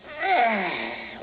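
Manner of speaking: weary sigh. Acted. Tired sigh.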